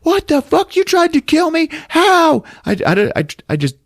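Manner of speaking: high, loud, frantic back-and-forth; hammed-up screaming match; yelled argument. Mother admits miscarriage attempt to son, both yelling. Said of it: "they're yelling at each other".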